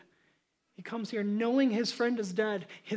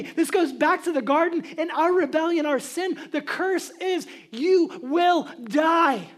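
fiery preaching; 3 semitones higher, 6 dB louder than usual. Acted. Preacher to congregation, roaring at death's fury. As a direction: preach it at full force.

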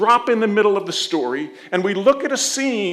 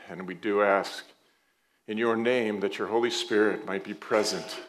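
soft, earnest prayer voice, lower; 10 semitones lower, 5 dB quieter than usual. Acted. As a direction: pray quietly and sincerely to God. Pastor leads congregation prayer.

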